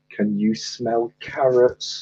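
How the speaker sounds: slow, drawn-out joke punchline; slow repeat. Acted. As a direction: spell out the punchline slowly at normal pitch. Repeats punchline for puzzled listener.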